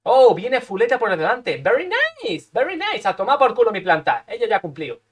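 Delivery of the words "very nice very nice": much higher, put-on very nice; high comic English catchphrase; Borat-style very nice. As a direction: say it like a goofy catchphrase.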